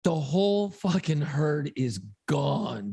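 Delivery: ordinary talk, nothing marked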